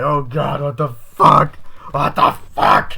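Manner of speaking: loud, strained cries fading to a rasp; hammed-up shock, last words slowed and breathy; group shrieking in horror, then dropping to a strained rasp; mock horrified yell.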